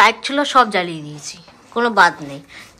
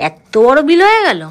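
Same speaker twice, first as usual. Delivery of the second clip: loud, high-swooping disbelief; shocked exclamation; 5 semitones higher, 9 dB louder than usual. Real, not acted. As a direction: exclaim in shock at a huge bill. Shocked at the size of the bill.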